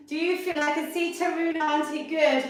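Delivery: ordinary delivery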